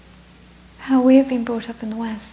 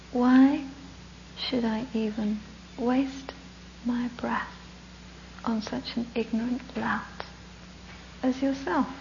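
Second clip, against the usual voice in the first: soft, unhurried monk with long pauses; very gentle, calm; 8 dB quieter, slower than usual. Acted. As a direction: insult him softly and serenely. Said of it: "very gently, very calmly".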